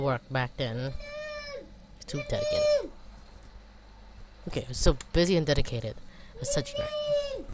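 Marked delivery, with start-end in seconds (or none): 2.1-2.9 high squeaky aside; mock yourself in a high voice; squeaky self-tease. 6.4-7.4 high squeaky aside; mock yourself in a high voice; squeaky self-tease.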